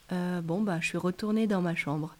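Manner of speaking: plain reading voice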